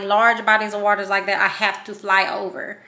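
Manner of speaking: ordinary talk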